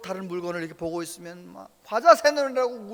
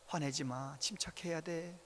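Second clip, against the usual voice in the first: calm voice; 5 semitones lower, 8 dB quieter than usual. Retold or imitated. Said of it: "차분한 소리로 그러는 거예요".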